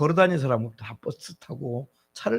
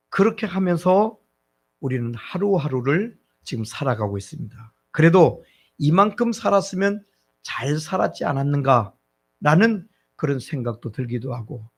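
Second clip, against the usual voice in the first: ordinary delivery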